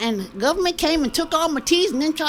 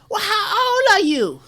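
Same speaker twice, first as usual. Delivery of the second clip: put-on little boy asking; 7 semitones higher, slower than usual. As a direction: blurt it out like a curious kid. Little boy asks in joke.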